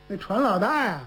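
ordinary delivery, nothing marked

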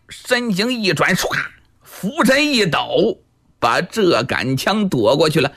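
ordinary delivery, nothing marked

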